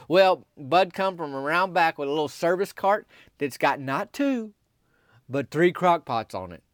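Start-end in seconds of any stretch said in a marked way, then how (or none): none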